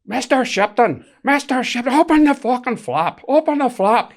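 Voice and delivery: high, loud Northern Irish demand through a door; Northern Irish accent. Retold or imitated. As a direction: shout Mister Shipton in a Northern Irish accent. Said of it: "I'm not bad at your Northern Irish accent".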